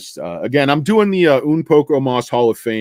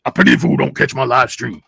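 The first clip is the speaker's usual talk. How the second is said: loud tough-guy catchphrase impression; 4 semitones higher, 4 dB louder than usual. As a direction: growl it out as a comic TV tough guy. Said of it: "Mister T".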